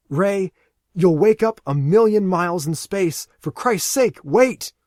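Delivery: loud, high plea; desperate shout. Acted. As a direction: yell at him to wait. Aaron pleads with Ray.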